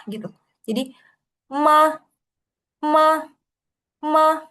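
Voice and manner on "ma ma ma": MA climbing to a high pitch; rising second tone. Shown as a demonstration. Teacher reviewing tone two, MA rising high. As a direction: lift MA up like a question. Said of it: "Kemudian ada dua".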